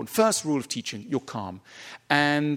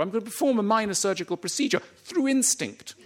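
mock cheery surgeon; 7 semitones higher than usual.

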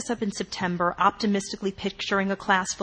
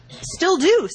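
bright, much higher and louder quip; cheerful; 10 semitones higher, 6 dB louder than usual. Acted. Friend joking about hating her mother, cheerful and high. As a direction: toss it off gleefully. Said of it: "Sam said cheerfully".

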